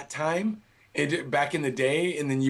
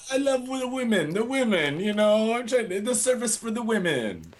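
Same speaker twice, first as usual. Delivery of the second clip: a lightly put-on Italian accent, a bit higher; 6 semitones higher than usual. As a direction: play a suave Italian with a mock Italian accent. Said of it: "you're doing more of an Italian accent".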